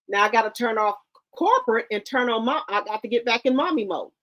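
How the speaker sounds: ordinary talk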